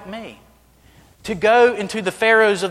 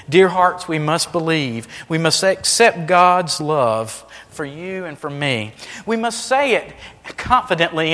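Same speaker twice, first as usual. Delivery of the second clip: ordinary delivery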